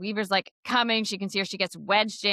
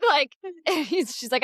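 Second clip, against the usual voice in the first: normal talking voice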